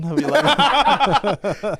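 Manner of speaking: high, very slow Welsh crooning with a laugh; Welsh impression. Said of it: "That's my Welsh impression".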